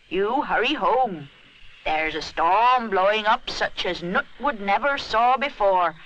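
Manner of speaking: put-on slow, ominous old woman's warning; wise old granny. A narrator's reading. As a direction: warn him like a wise old seer.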